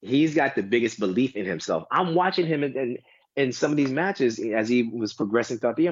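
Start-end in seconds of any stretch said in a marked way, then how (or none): none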